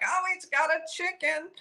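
imitating a lighthearted remark, pitched high; light, high quoted exclamation, no urgency. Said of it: "Maggie seemed very lighthearted".

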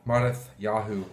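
Mattityahu said the Hebrew way, mah-tee-TYAH-hoo; Hebrew name. Shown as a demonstration. Pastor naming the Bible book in Hebrew, Mattityahu sounded out. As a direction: give Mattityahu its Hebrew sounds, not Matthew. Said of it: "or in Hebrew".

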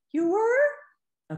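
high, loud mock surprise "You were?". Shown as a demonstration. Therapist answering the child's gestalt, mock surprised. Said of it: "I'll just say this out loud for Jen's sake".

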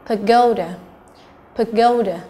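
"pagoda" stressed on GO; second-syllable stress. Shown as a demonstration. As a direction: punch the middle of "pagoda". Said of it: "là trọng âm thứ hai".

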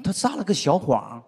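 usual speaking voice

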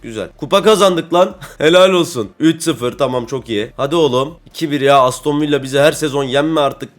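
ordinary talk, nothing marked

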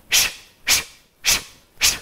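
short detached sh bursts; staccato shushing. Shown as a demonstration. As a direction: clip each sh short. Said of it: "called staccato, just like this".

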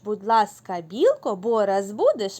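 loud, bouncy recitation of a nursery rhyme; lively loud rhyme reading; children's rhyme. Acted. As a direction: chant the rhyme for little kids.

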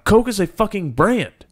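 high, loud, indignant shout; outraged yell. Real, not acted. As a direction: yell it like it's obvious. Heated soda argument, yelling.

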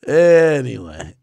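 slow, loud, stretched "Anyway"; drawn-out. Real, not acted. Streamer moving on after the joke, drawn-out. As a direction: drag out the word ANYWAY.

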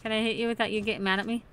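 ordinary talk

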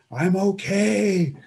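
slow, high, loud call down the slope; retold scream. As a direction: shout it down, forcing it to sound fine. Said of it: "I scream down to him".

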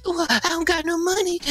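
high, breathless mock whimper; hyperventilating dealer in a mocking high voice; imitating panicked dealer. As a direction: squeak it out like a terrified guy. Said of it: "And he's like".

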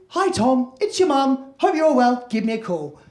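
breezy, high answerphone message; a brief cheery check-in from mum; mum's short voicemail. Retold or imitated. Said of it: "The second one's the same".

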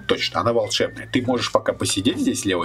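ordinary talk

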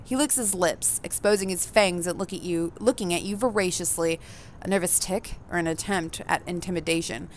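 even, measured narrator read; story narration. A narrator's reading. Reading the game's narration to the audience, evenly. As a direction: tell the player what they see and feel.

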